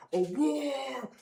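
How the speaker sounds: long drawn-out mock roar; slow, roaring imitation of a lion; imitated lion roar. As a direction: stretch "roar" slowly like a lion. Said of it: "a roar sound".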